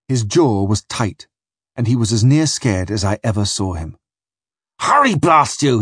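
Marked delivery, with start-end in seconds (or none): 4.8-5.8 shouting. Acted. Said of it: "he shouted".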